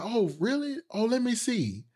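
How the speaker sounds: put-on high-pitched scheming kid voice; mock-amazed, wheedling high voice; feigned interest, imitated. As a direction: coax like a kid playing impressed.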